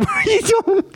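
high-pitched, laughing question; died laughing. Retold or imitated. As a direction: squeal it out through laughter. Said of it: "I just died laughing".

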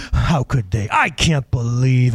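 low, drawn-out stewing over a slight; mock brooding upset. Driver still stewing after being honked at, mock upset. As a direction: grumble slowly, hammed-up indignation. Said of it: "still be really upset about it".

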